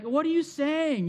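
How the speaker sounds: imitated outrage, high and drawn out; mock angry. Angry religious leaders challenge Jesus, mock outrage. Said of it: "they would just get so angry".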